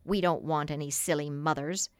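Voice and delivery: put-on low, lightly scornful boy; understated sneering disdain; mild sneer. A narrator's reading. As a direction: dismiss them with a touch of contempt. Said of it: "with a frightful sneer".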